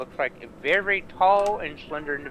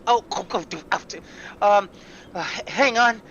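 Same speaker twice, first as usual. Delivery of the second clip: flustered gnome; 6 semitones higher, 7 dB louder than usual. Acted.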